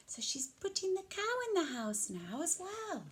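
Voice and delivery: ordinary delivery, nothing marked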